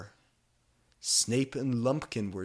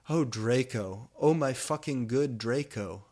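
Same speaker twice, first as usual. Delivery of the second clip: mock passion; 3 semitones higher, 5 dB louder than usual. A narrator's reading. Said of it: "I screamed passively".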